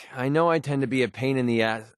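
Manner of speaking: ordinary talk, nothing marked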